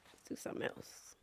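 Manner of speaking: voiceless whisper; whispered aside. Retold or imitated. Director whispering a change of plan on set. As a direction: breathe it out under your breath. Tyler quoted muttering on set.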